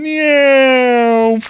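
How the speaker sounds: high loud cartoon meow; loud playful meow; cat meow. Cat imitation.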